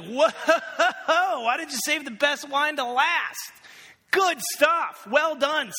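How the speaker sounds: loud, high, hearty praise; booming, slap-on-the-back delight; delighted guest. Retold or imitated. Party guest praises the host's wine, loud and delighted. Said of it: "he's like".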